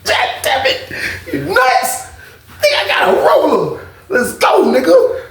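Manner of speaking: high, strained excited squeal; giddy high-pitched hype without shouting; hyped self-pep talk. Retold or imitated. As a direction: psych yourself up in the mirror. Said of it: "talking to my damn self".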